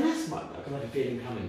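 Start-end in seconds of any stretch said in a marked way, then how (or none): 0.0-0.4 much higher, squeaky spirit quote; spirit's voice. Retold or imitated. Medium relaying the spirit to friends, high and squeaky. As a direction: pitch the spirit's words way up. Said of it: "He said".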